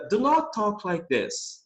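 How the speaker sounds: drawn-out example sentence; slow teaching read. Shown as a demonstration. Teacher modelling a command to the class, very slow. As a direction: lay each word out slowly. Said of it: "eu tô dizendo".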